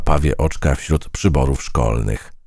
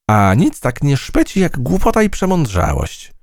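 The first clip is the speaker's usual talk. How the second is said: fly voice; 11 semitones higher than usual. A narrator's reading. Fly complimenting the mouse's grey fur, high. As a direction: return the compliment sweetly up high.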